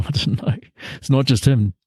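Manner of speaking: ordinary delivery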